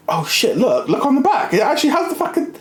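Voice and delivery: high, fast, excited realisation; squeaky high excitement, slowing at the end; excited discovery. Retold or imitated. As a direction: blurt it out like you've just cracked the code. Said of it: "and I was like".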